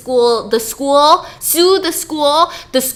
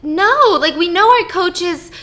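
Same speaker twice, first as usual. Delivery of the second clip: flustered denial; 6 semitones higher, 3 dB louder, much slower than usual. Retold or imitated. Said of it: "Kelly was like".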